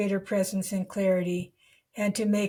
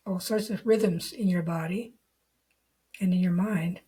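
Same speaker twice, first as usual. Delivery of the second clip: guided meditation. Real, not acted. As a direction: lead the listener unhurriedly into the body, leaving long silences.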